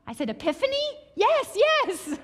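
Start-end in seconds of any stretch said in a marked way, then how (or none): 0.3-0.9 high, rising question; eager guess. Retold or imitated. Guessing the word for the guide, high eager rise. 1.1-2.2 high and loud, delighted; excited yes. Retold or imitated. Guide delighted she was understood, high excited yes.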